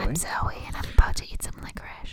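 breath-only whisper, quick; whispered introduction. Pretend. Co-host announces licorice tasting to listeners, whispering. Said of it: "That whispered voice was Zoe saying".

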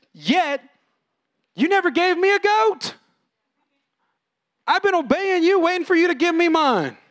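high, loud whining complaint; aggrieved older brother. Retold or imitated. Older brother complains to his father, high and aggrieved. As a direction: demand what he is owed. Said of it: "he says".

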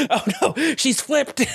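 high, laughing mock alarm; laughing mock dismay. Acting out player's reaction, laughing.

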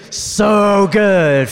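big loud, high group shout; yelled from the gut. Real, not acted. Preacher leading the congregation's yelled response, loud. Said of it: "you guys yell out the choice".

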